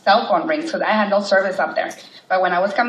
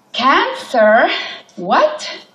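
high, loud stunned cry; shocked disbelief; 6 semitones higher, 5 dB louder, much slower than usual. Retold or imitated. Mother hearing the diagnosis, high shocked cry. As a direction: hit it like a blow.

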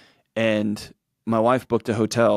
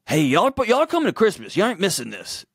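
mock demanding; 9 semitones higher than usual. Imagined demand to his parents.

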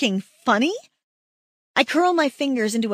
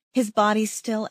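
ordinary talk, nothing marked

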